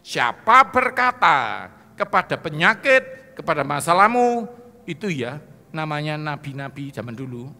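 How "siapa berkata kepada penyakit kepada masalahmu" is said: slow, loud proclamation; proclaimed scripture. Acted. Preacher quoting Jesus to congregation, slow and loud. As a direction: boom out the words of Jesus slowly.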